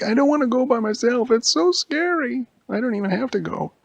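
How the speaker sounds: put-on a kid too scared to go alone; scared whiny boy.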